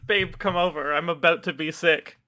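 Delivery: usual speaking voice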